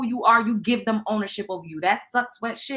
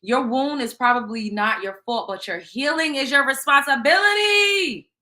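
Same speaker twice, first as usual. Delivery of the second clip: emphatic declaration; 4 semitones higher, 6 dB louder than usual. Acted. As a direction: drive it home like a preacher. Reading tarot card aloud.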